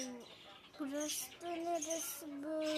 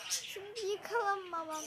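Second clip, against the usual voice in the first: ordinary talk, nothing marked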